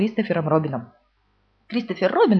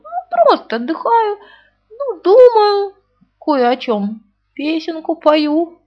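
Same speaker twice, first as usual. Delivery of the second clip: much higher, louder, slow fake cheer; forced cheerful; 9 semitones higher, 6 dB louder, slower than usual. A narrator's reading. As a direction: bluff it out sweetly. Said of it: "стараясь говорить весёлым голосом".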